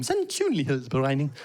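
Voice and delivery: lightly recited, like a tiny poem; like a little poem. Shown as a demonstration. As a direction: savour the long Danish word as poetry. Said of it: "Sounds like a little poem".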